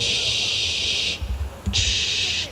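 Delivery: soft, sustained "shh" of running water; imitated shower hiss. Listening at the bathroom door, imitated shower hiss. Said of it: "I put my ear on the door".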